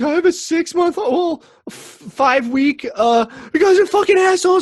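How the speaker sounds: very high, loud, cracking rant; voice-cracking angry yell. Retold or imitated. Retelling yelling at the neighbors, voice cracking. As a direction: yell through near tears. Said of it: "about to cry. I was so angry".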